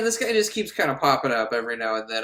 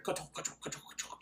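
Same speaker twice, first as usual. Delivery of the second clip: hushed, toneless rhythmic hoofbeats; imitated galloping; 19 dB quieter, faster than usual. Woman walks to the fountain, hushed imitation of galloping heels. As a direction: clop along under your breath like a horse. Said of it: "it sounds like galloping".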